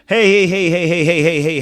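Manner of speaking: loud, rising calls of hey to cut her off; hey, hey, hey, telling her off; scolding hey. Real, not acted. Scolding the crying girl on screen, loud repeated hey.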